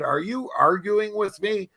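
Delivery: mildly put-on stern police officer, slowed; a lightly voiced cop who won't be corrected. Police officer scolding a lost driver, a hint of stern cop. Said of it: "he looked at me and he said".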